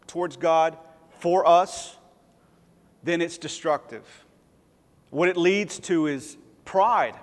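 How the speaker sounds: usual speaking voice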